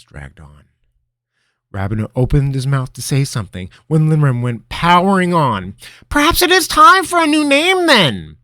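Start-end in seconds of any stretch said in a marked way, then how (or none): 6.1-8.4 high, loud, manic wizard voice; mad grin. Acted. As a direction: grin madly through it. Linram scheming, excited.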